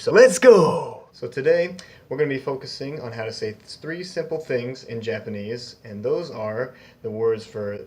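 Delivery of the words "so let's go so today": loud, high, drawn-out rallying cry; excited kickoff. Real, not acted. Host rallying viewers to start the lesson, excited. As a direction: launch it with a big stretched shout.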